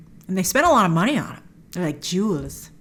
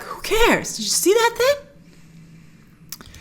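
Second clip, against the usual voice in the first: mock excited; 12 semitones higher, much slower than usual. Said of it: "I'd be like".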